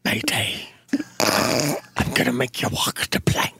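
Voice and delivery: raspy pirate growl; hoarse pirate with laryngitis. Acted. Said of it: "Pirate with laryngitis".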